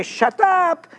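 "Shut up" said high and falling, playful; Shut up as slang. Shown as a demonstration. Preacher to congregation, "Shut up" said high and playful. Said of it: "When I say".

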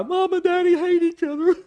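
high, whiny imitation of a crying kid; mock crying child. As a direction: wail it like an upset child. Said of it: "the kids are starting to cry".

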